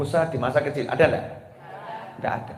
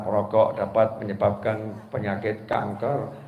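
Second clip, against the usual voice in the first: teasing slow read; 5 semitones lower, 4 dB quieter, slower than usual. Retold or imitated. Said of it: "Canda sama mbaknya".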